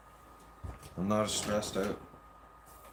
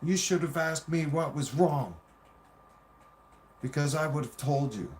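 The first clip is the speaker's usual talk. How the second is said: higher-pitched imitation of a snappy partner; mildly mocking reproach in a raised pitch; snapping; 6 semitones higher than usual. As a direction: scold as the aggrieved partner, retold. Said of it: "she snaps on me and says".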